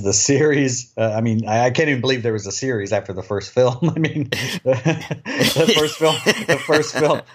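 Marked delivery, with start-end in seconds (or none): 3.8-7.2 laughing. Real, not acted. Laughing at the sequel idea.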